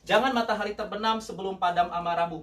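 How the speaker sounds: ordinary talk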